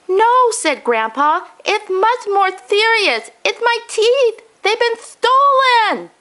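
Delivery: put-on high old man's voice, grave and upset; a grandfather announcing a tragedy; distressed grandpa. Grandpa's voice in the story.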